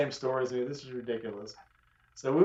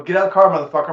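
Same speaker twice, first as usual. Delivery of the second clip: much louder, threatening yell; angry yell; 4 semitones higher, 10 dB louder, faster than usual. Retold or imitated. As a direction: bark the demand at the car window. Confronting driver at pump.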